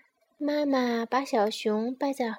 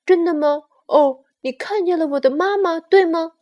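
loud, higher, thrilled questioning; happy little bear; 5 semitones higher, 12 dB louder, faster than usual. Acted. Bear asks if sparrow saw mama, overjoyed. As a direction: burst out with joy as the cub. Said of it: "高兴地说".

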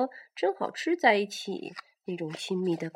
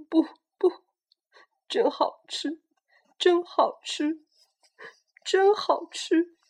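crying; 6 semitones higher, 6 dB louder, slower than usual. Acted. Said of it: "霸王龙哭着".

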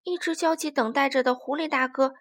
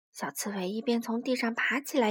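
usual speaking voice

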